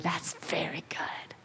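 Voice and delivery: hushed whisper of approval. Acted. God whispering his approval over creation. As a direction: breathe it as a tender whisper.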